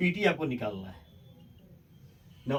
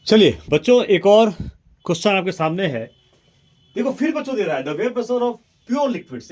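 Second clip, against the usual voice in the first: energetic call to class; 5 semitones higher, 12 dB louder than usual. Real, not acted. Teacher launches new question loudly.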